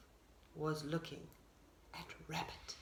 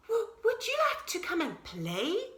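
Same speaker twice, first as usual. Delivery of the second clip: higher, hopeful little deer asking to play; 8 semitones higher than usual. A narrator's reading. Shy deer asks Beaver to come play, high and hopeful. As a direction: ask sweetly in a raised little-deer voice.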